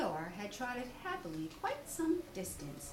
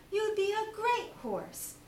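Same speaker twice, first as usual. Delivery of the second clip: higher, eager cry; cried Christopher Robin; 10 semitones higher than usual. Acted. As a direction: brightly cheer Eeyore on. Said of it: "cried Christopher Robin".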